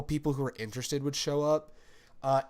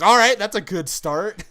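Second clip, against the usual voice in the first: louder, higher ironic praise; mock approving quip; wry approval; 6 semitones higher, 12 dB louder than usual. Host reacting to awkward explanation, loud wry quip. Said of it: "And I'm like".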